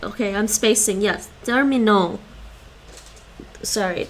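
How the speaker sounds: ordinary talk, nothing marked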